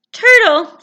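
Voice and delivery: calling out. Acted. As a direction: call across the water. Frog calling turtle.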